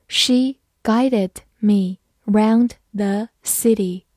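very slow, careful read-through. Shown as a demonstration. Teacher models the sentence slowly for students, slow. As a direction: stretch it out word by word. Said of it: "好，慢慢来读".

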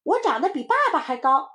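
put-on high, loud excited kid; boasting little child. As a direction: crow about growing tall. Children try to outboast each other. (小孩兴奋地高声夸口)

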